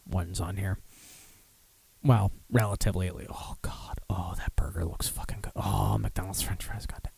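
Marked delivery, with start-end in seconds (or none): 2.0-4.0 unvoiced, quick aside under the breath; muttered correction, barely voiced; whispered aside. Real, not acted. 4.1-7.1 softer, unvoiced whisper of longing; whispering over the burger photo; craving whisper. Real, not acted. Drooling over food pictures.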